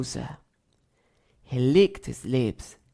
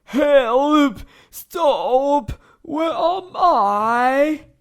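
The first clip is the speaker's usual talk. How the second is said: loud, high, dragged-out wail; panicked cries; 10 semitones higher, 9 dB louder, much slower than usual. A narrator's reading. Crocodile knocked flying, crying for help. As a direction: cry out while tumbling.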